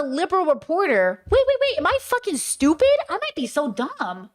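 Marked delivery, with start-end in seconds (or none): 1.3-4.3 much higher, disbelieving at herself; incredulous self-doubt. Real, not acted. Realising she may be wrong about Taylor, high and incredulous. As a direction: scold yourself in rising alarm.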